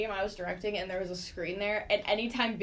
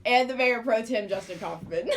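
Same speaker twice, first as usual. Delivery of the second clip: ordinary talk, nothing marked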